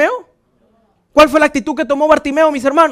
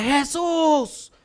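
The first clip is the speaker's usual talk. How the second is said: imitated cry; 3 semitones higher, 7 dB quieter than usual. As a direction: cry out as the beggar calling for help. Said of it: "gritó más fuerte". (clamor imitado, repentino y agudo)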